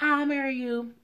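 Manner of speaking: high, eager imitation of her; mock eager acceptance. Woman accepting the proposal, high mock eagerness. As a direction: squeal the acceptance.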